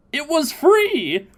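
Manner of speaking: high, slow gleeful cry; gleeful high squeal over a freebie; excited. Pretend. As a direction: savor the word free like a bargain hunter. Gushing about the sale.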